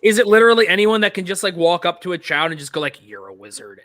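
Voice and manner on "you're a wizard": very low, hushed delivery; creepy hushed imitation; mock whispering stranger. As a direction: drop right down, as if secretly telling a child. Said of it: "whispering in a sli".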